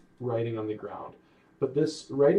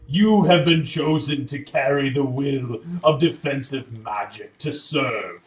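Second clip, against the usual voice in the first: very loud, stately proclamation; booming voice; 9 dB louder than usual. Acted. Magical voice reciting the saying to chosen wizard, booming. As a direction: boom out the name slowly, then pronounce the charge. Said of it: "you hear a booming voice".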